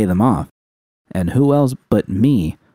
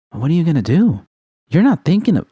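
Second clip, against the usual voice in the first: higher, rising alarmed question; alarmed Judy; 9 semitones higher than usual. Acted. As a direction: cut in, worried where this goes.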